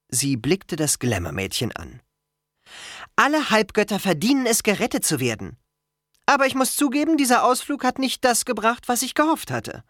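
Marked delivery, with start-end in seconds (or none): 3.2-9.8 high-pitched character voice, earnest; candid, disappointed Annabeth. A narrator's reading. Girl admits the trip fell short, earnest and high. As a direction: concede disappointment in a raised voice.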